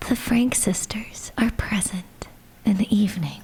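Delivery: soft whispered reading; ASMR whisper. Acted. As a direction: read it as an ASMR host.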